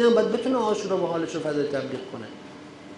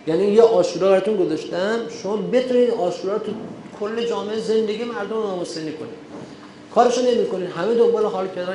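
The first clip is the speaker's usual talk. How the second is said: rising preaching; 4 semitones higher than usual. Acted. Sermon rising to full force.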